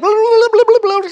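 high, loud imitation of Korean gibberish; mock Korean chatter. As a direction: babble mock Korean like an animated mom on the phone. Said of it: "she was talking Korean".

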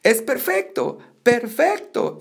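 excited exclamation. A narrator's reading. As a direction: exclaim it with a bouncy lift in pitch. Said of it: "exclamó Gabriel dando brincos".

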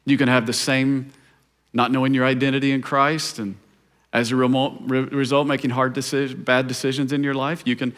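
hushed, gentle preaching; intimate sermon. Acted.

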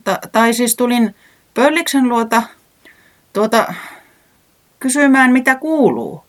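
high, halting stammer, tripping over the words; nervous stutter of a voiced weatherman. A narrator's reading. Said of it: "takelteli säämies". (korkea, takelteleva ja änkyttävä ääni)

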